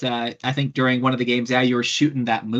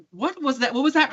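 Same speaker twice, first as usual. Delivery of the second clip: startled disbelief; 10 semitones higher than usual. Retold or imitated. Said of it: "I'm like".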